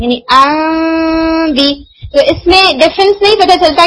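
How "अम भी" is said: अम भी with slightly open lips, nasal held; hidden nun sound. Shown as a demonstration. Teacher shows how paper-gap अम sounds like इख्फा. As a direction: let अम drift toward a hidden नून. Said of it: "नून इख्फा की तरफ".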